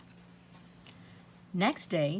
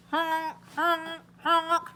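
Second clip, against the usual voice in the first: voiced honks, climbing much higher and louder; nose honking; 10 semitones higher, 4 dB louder, much slower than usual. A narrator's reading. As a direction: honk like a nose being blown. Boy blows his nose.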